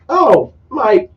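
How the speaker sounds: high-pitched mock dismay; imitated exclamation. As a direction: squeal it as an impression. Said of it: "he's like".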